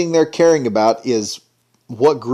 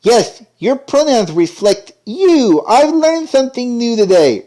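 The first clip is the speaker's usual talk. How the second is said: much higher, slow, syrupy mock voice; mocking sweet voice; 10 semitones higher, 3 dB louder than usual. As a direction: parody a preachy cartoon kid. Mocking cartoon dialogue.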